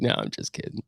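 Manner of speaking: soft, mock-sheepish whisper; whispered take-back. Pretending to tell the pulled-over cop it was a joke, whispered. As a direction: whisper it like a cheeky retraction.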